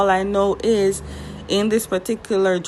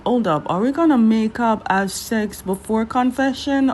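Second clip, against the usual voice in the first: usual speaking voice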